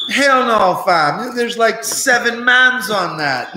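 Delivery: high, slow Toronto Mans slang accent imitation; buddy refusing the blunt in high, slow Toronto Mans slang. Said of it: "Toronto Mans ... talking like Jamaican".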